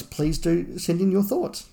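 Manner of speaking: ordinary delivery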